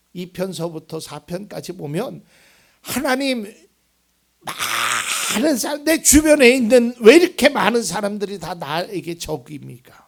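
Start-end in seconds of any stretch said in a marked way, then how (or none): 2.8-10.0 high, loud cry of grievance; anguished lament. Acted. Preacher voicing David crying out against his enemies, anguished. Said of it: "이런 괴로운 심정을 토로했단 말이죠".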